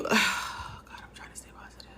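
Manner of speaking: a sighed, barely voiced whisper; almost out of voice, whispering through tears; tearful whisper. Real, not acted. Breaking down on camera.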